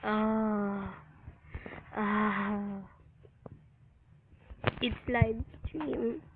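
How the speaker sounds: ordinary talk, nothing marked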